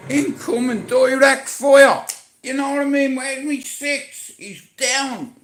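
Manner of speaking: high, loud battlefield callouts; man down shout. Retold or imitated. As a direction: bark it like under fire. Acting out firefight callouts.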